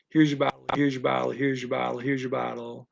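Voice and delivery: evenly repeated, losing volume each time; constant repetition. Retold or imitated. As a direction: imitate a phrase looping round a child, tailing off. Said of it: "these constantly repeated phrases".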